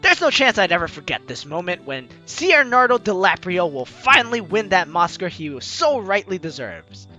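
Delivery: ordinary delivery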